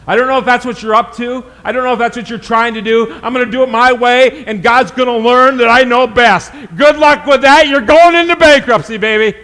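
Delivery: fast and loud, mocking the proud boast; fiery preaching. Pastor warning the congregation against going their own way, preaching loud. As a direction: warn them hard with a laughing jab.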